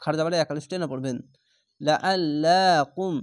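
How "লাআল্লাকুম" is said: লাআল্লাকুম with a thick, full লা, drawn slowly; heavy Allah sound. Shown as a demonstration. Teacher showing the heavy reading, slow and chanted. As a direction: read the লা of লাআল্লাকুম heavy and long. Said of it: "লহা মোটা করে পড়বেন".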